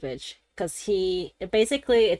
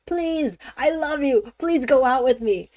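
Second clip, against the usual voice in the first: high, loud mock begging; exaggerated lovesick pleading; groveling plea; 5 semitones higher, 5 dB louder than usual. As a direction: beg desperately, hammed-up and high-pitched. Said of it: "groveling on the ground".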